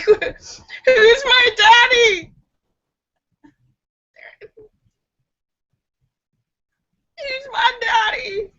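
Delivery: very high, slow crying "who's my daddy"; crying baby voice. Retold or imitated. Confused baby asking for dad, high slow crying. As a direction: wail it like a lost toddler.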